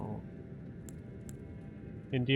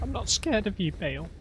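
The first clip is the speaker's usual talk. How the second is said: false bravado; 9 semitones higher than usual. Pretend. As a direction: puff up and pretend not to be scared. Faces a dragon boss.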